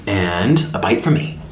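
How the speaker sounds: ordinary delivery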